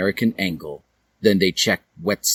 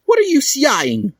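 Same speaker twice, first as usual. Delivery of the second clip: high, loud demand; 5 dB louder than usual. A narrator's reading. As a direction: snap the question at them. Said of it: "Hiei demanded".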